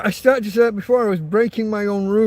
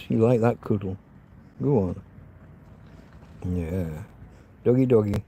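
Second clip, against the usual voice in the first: cuddling the dog; 9 semitones lower than usual. Real, not acted. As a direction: murmur tenderly to her.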